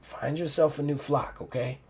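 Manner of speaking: put-on soft, fading old woman's voice; dying mentor, tender. Dying mentor thanks Ruby, soft and tender. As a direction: speak gently through the weakness.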